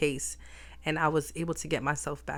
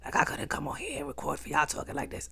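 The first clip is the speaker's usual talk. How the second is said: voiceless, croaky rasp of a lost voice; 3 semitones lower, 4 dB quieter than usual. Shown as a demonstration. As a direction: talk with barely any voice left. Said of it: "Before, I was talking like that".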